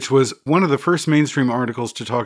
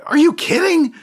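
incredulous; 5 dB louder than usual. Retold or imitated. Retold disbelief at editors.

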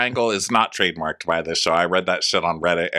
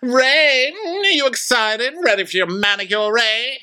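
Karen. Retold or imitated. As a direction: sing it out high to your husband. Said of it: "it's Karen and Ray".

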